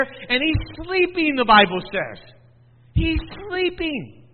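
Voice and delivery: high, slow, dreamy sleeping; drawn-out sleeping. Acted. Describes Jesus sleeping.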